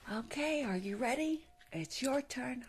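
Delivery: ordinary talk, nothing marked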